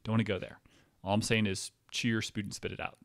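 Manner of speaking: normal talking voice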